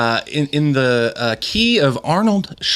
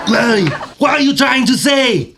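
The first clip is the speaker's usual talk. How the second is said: Arnold Schwarzenegger voice; 6 semitones higher than usual. Acted. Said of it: "in the key of Arnold Schwarzenegger".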